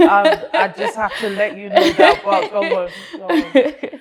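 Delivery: high, loud words through laughter; laughing. Real, not acted. Interrupting her friend's painful story, laughing. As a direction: laugh as you cut in.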